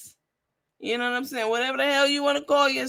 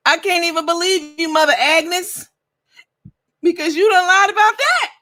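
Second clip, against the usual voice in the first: louder and higher, calling her out; 7 semitones higher, 8 dB louder than usual. Real, not acted. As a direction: shout it at her, exasperated. Loudly calling out her lie.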